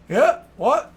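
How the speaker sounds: high, loud put-upon answer; lazy shouted reply. Acted. Bastian shouts back from the other room, annoyed. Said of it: "and he's like".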